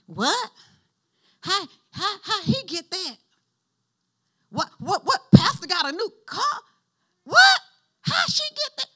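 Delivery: hammed-up jealous gossip, disbelieving; jealous church folk. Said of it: "But see, some folk".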